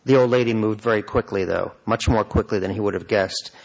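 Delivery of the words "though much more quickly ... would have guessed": plain reading voice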